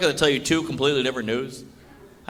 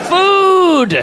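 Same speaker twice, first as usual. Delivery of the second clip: food punched much higher and louder; stress on food; 13 semitones higher, 6 dB louder than usual. Acted. Comedian hits the punchline word to the crowd, loud. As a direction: land food like a shout. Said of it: "punchline emphasis".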